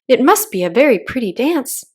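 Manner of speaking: ordinary delivery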